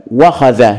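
"وخذ" run together as "wakhadha"; hamza dropped. Shown as a demonstration. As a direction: go from wa straight into kh in "وخذ". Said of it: "الكلمة بها خلل". (نطق «وخذ» موصولة بدون همزة)

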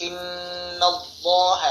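slowly drawn ইন্নাল্লাহ with a thick lam; heavy Allah. Shown as a demonstration. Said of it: "মোটা করতে হবে".

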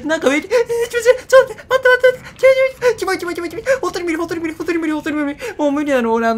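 high, shrieking, disgusted panic; panicked shriek. Real, not acted. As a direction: squeal in horror at the bug. Slug above him, panics. (嫌悪の悲鳴)

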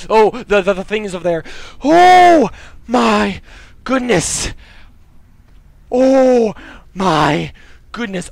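loud, high, stammering fright; shaken exclaiming. Real, not acted. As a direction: cry out, still reeling from the scare. Reacting to monster scare.

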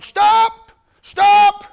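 barked stop. Retold or imitated. Prison guard shouting at the fallen kid, loud yell. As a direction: yell stop at full volume. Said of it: "he's running, gone".

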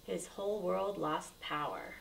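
ordinary delivery, nothing marked

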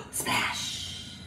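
hushed, voiceless splash; whispered splash. Pretend. Teacher dunking a kitty in paint for children, whispered. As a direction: whisper it like a little sound effect.

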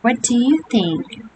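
ordinary delivery

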